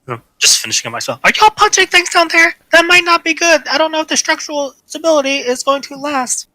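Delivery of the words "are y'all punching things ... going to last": high, worried character voice; high nervous character voice. Acted. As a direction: fret upward like a nervous scholar.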